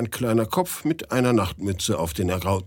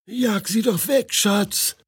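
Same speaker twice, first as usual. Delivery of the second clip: higher, lightly voiced wife; wife's voice; 10 semitones higher than usual. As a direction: toss it back to him like a tired wife. Wife answers Aljoscha.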